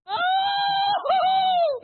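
mock terrified shriek, very high and loud; a comic cry of panic as he runs; scared scream. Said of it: "Elijah goes".

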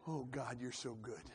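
hushed, low murmur to God; moaned prayer. Shown as a demonstration. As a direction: pray it privately under your voice. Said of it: "learning how to moan in the spirit".

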